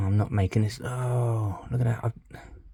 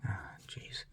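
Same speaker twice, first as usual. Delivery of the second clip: breathy unvoiced whisper; concentrating whisper over fiddly repair; 18 dB quieter than usual. Real, not acted. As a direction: whisper it under your breath. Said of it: "I don't know why I'm whispering".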